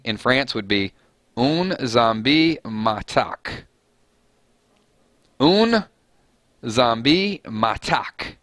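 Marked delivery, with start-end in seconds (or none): 1.4-3.7 very slow French with every syllable sounded out; slow, careful French enunciation; enunciated French. Shown as a demonstration. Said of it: "I'm just gonna enunciate this all the way through". 5.4-8.4 very slow French with every syllable sounded out; slow, careful French enunciation; enunciated French. Shown as a demonstration. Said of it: "enunciate this all the way through".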